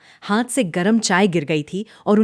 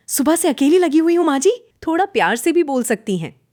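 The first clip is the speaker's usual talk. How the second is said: suppressed anger; 9 semitones higher, 4 dB louder than usual. A narrator's reading. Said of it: "धीमे लेकिन दबे गुस्से में कहा".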